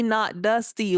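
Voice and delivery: ordinary delivery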